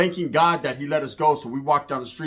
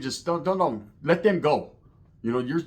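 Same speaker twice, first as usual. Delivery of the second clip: ordinary delivery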